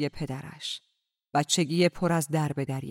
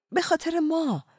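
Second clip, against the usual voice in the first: "به خاطر ما" said much higher, pleading; excited plea; 12 semitones higher than usual. A narrator's reading. Ella urging Aziz to fight, excited. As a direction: lift "به" high with eager urgency. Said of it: "هیجان زده گفت".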